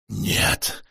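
rasped, breathless whisper of a no; hoarse rasp. Acted. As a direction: croak it out, barely voiced. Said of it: "прохрипел он наконец".

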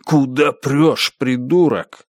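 slow, hissed insult. A narrator's reading. Santa Claus nearly knocked over, hissing crossly. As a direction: hiss it slowly at someone in the way. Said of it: "который прошипел".

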